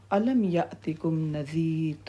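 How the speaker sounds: soft, very slow Arabic recitation; keeper's recited question. Acted. Keeper of Hell addressing the damned, slow hushed recitation. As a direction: draw the verse out quietly and slowly. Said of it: "جہنم کا داروغہ کہے گا".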